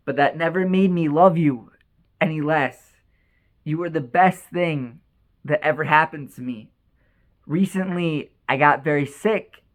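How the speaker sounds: low, measured voice with long pauses; solemn letter reading. Acted. Father writes lovingly to his estranged son, solemn and low.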